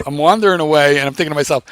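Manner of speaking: usual speaking voice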